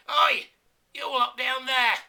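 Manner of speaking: high, loud cocky insult yelled uphill; loud, high cocky Yorkshireman yell. Acted. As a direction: bellow it down the hill, cocky and sure. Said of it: "yelled the Yorkshireman, cocky and sure".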